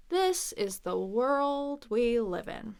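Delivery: very slow, stretched words; dragged out for emphasis. Shown as a demonstration. Teacher drags it out for emphasis, slowly. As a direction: drag each word out emphatically. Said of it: "You can drag it out for emphasis".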